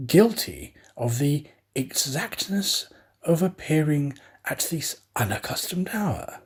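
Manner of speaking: ordinary delivery, nothing marked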